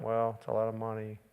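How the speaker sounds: soft, low reasoning voice; mock counselor. Preacher mocking a counselor who advises keeping the money, soft. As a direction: murmur it like a cautious counselor. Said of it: "the person there going".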